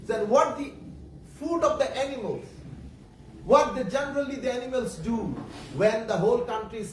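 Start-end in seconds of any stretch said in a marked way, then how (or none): none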